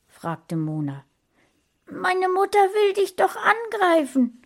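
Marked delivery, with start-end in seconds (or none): 1.9-4.4 put-on high, loud boyish voice, alarmed; Sascha's voice. A narrator's reading. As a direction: play the frightened boy, pitched up. Boy warns about his mother. (hohe, aufgeregte Jungenstimme)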